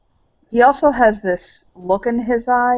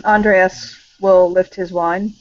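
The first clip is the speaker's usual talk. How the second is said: normal talking voice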